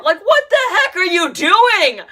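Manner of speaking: loud, high, incredulous outburst; worked-up mock tirade; exasperated shouting. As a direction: yell at him in disbelief. Exasperated rant at the actor.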